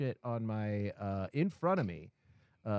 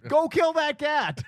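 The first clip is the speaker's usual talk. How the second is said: very high, loud, drawn-out scream; furious yell; 11 dB louder, slower than usual. Acted. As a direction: explode at the vet at full volume. Climax of rant at the vet.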